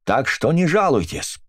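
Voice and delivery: high, pushed accusing shout; angry shout. A narrator's reading. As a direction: fling it at her. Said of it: "крикнула миссис Брикстер".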